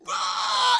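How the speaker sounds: very high, loud imitated screech; hammed-up zombie attack cry; zombie scream. [name] turns around at Anna, mock zombie screech.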